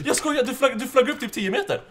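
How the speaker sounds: high-pitched, excited report; amazed excitement. Real, not acted. Player telling teammate how they flew up, amazed and high-pitched. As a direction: tell it still giddy and high with amazement.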